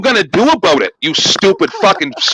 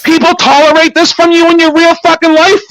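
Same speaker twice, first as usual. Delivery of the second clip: angry shout; 5 semitones higher than usual. Real, not acted. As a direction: hurl contempt at her. Furious rant at panel guest.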